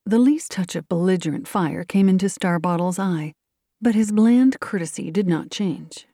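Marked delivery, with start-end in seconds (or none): none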